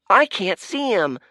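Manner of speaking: high, loud complaint; frustrated call. Acted. Crowd member straining to see, high and loud.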